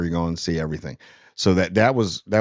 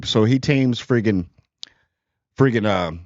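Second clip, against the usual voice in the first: normal talking voice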